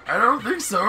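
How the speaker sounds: ordinary delivery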